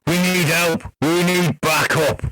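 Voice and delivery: slow, thick northern English accent, gruff cop on the radio; a heavy Yorkshire accent, calling for backup; Sean Bean. Shown as a demonstration. Host voicing a stranded traffic cop on the radio in a slow northern accent. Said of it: "like Sean Bean".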